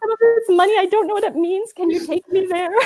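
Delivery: high, panicky mock babble; hammed-up lost traveler, ending in a laugh; flustered pleading. Acts out lost, flustered plea.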